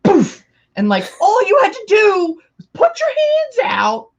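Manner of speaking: high, loud, whiny outburst; mock raging. Friend replays a blow-up over a Coke, mock raging. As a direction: explode into exasperated, play-acted complaint. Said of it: "like, raging and then".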